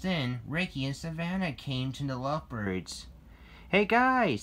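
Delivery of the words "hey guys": high, loud excited greeting. A narrator's reading. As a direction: call out with excitement. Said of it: "said Ricky excitedly".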